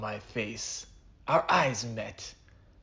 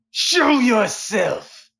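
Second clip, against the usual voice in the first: very slow, loud, high shout; cowboy calls out for the vanished assassin; 13 semitones higher, 11 dB louder, much slower than usual. Acted. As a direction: drag the yell out across the canyon. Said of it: "I said".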